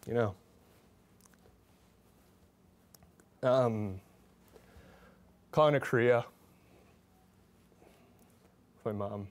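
halting, with long silences between phrases; a little emotional. Real, not acted. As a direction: struggle to go on, really moved. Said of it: "I'm getting a little emotional".

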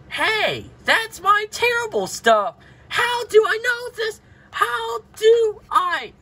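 very high, whiny play-cry; mock upset wail. Child protesting "my terrible stuff" in play, high wail. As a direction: wail it in a high voice, drawing out the end.

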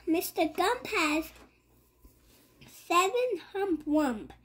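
usual speaking voice

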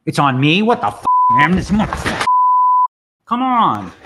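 loud, furious yelling at the frozen software; real angry yell. Real, not acted. Streamer yelling at his poker software, furious. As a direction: let it burst out at the screen in a rage. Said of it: "both dogs heard me yell".

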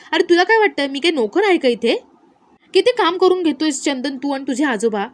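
high, loud complaint; irritated outburst. A narrator's reading. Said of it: "दिव्या चिडलेली असते". (चिडून, चढ्या आवाजात तक्रार)

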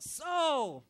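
loud high call across the distance; holler. Retold or imitated. David hollering out to Saul from the cave mouth, loud call. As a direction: call his name out far and loud. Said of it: "he hollers out".

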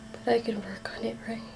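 quick whisper to herself; fast hopeful whisper. Acted. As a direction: coax herself in a hush. Inner voice whispered.

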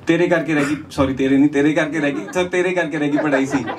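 normal talking voice

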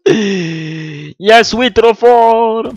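high, loud, slow victory laugh; triumphant laugh. Real, not acted. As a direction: crow over the win. After beating the monsters.

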